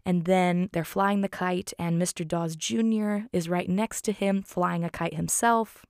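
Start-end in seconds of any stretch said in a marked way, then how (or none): none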